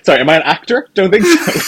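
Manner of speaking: high laughing disclaimer. Real, not acted. Laughing self-mockery.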